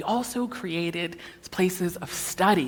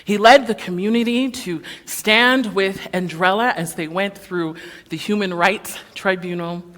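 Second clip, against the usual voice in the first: ordinary talk, nothing marked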